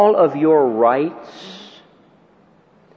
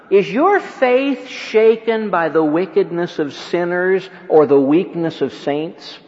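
normal talking voice

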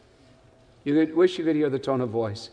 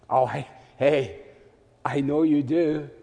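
lower, slow and gently teasing; endearing nudge; 4 semitones lower than usual. Retold or imitated. Paul gently nudging King Agrippa, slow and teasing. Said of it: "this endearing nudge".

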